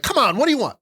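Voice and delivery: high-pitched, brash counterman impression; deli loudmouth. Host playing the guy behind the deli counter, high loudmouth. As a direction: pester the customer like a loudmouth. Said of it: "You need a loudmouth behind the fucking deli".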